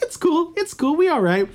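high, easygoing mock chill; laid-back reassurance. Quetzalcoatl telling the gods it's fine, laid-back and high. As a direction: brush it off like a relaxed buddy god. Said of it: "So he's like".

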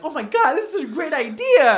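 high-pitched mock excitement; gushing fans. Everyone praising someone else's video idea, mock gushing. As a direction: gush it in a put-on high voice. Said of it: "everyone's like".

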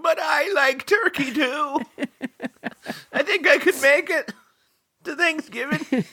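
put-on high, slow, frail old man voice; creaky elderly grandpa pleading. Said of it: "Picture some very old man going".

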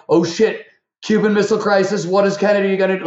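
high, fast, worked-up pretend panic; hammed-up breathless worry; mock alarm. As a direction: play an old man caught up in 1961 news. Acting out present-tense 1961 talk.

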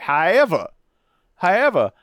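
a sly, theatrical 'however'; mock dramatic however. Host teasing the rival team with a theatrical however.

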